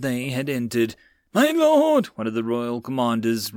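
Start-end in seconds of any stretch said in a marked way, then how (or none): 1.3-2.1 alarmed call. A narrator's reading. Commander rides up, alarmed.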